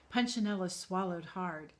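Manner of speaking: plain reading voice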